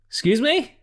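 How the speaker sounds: high, rising double take; mock incredulous. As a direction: react in exaggerated, high-pitched disbelief. Acts shocked reaction to Mystique's offer.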